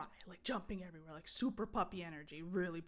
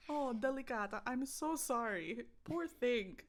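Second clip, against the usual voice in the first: raised, drawn-out cooing over the dog; mock sympathy; 6 semitones higher, 5 dB louder, slower than usual. Owner consoles the delicate dog, mock-sympathetic. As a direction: lay on the pity, slightly loud.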